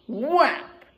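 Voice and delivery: punchy spoken whap for the smack; slap sound effect. Acted. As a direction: snap out the hit sound.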